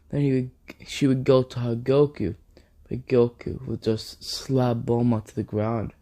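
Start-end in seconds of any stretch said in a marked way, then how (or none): none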